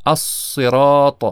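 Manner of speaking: الصراط with a heavy, full-mouthed ص; slow demo of الصراط, ص thick and lips rounded; الصراط said slowly with an emphatic ص; full emphatic saad. Shown as a demonstration. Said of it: "مثالهایی رو که مشتمل بر حرف صاد هست".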